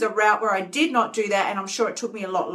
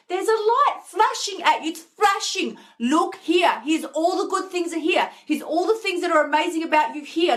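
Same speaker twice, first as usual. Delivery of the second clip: higher, sing-song coaxing call; be the light luring her back; 7 semitones higher than usual. Pretend. Light calling her to focus on the good, high and coaxing.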